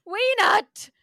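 hammed-up alarmed shout, high and loud; panicked researcher. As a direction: yell it like an anxious scientist in a panic. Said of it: "he turns to you and goes".

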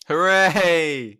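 loud high hooray; cheering. Acted. Cheering the pigeon segment.

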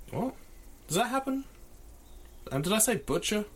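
catching his own slip; surprised. Real, not acted. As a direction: react in disbelief at the quote.

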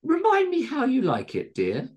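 very high landlady voice, dropping at the end; high-pitched, put-upon old dear; weary Missus Tingleberry. A narrator's reading. Missus Tingleberry humouring her guest at breakfast, wearily. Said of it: "said Missus Tingleberry wearily".

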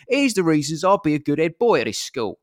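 high, loud mock Russell Brand; nervous schoolboy Russell Brand accent; Russell Brand impression. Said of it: "my voice just went to".

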